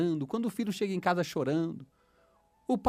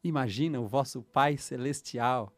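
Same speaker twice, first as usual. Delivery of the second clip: low, gentle preacher voice, softer; soft sermon opening; 5 semitones lower, 5 dB quieter than usual. Acted. Preacher to his listeners, gentle and soft. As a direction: draw listeners in quietly.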